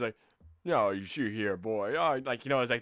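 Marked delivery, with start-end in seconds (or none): 0.6-1.9 put-on lower, louder old Texan drawl; old Texan dude. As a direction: imitate an old man from Texas. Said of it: "Texan old dude".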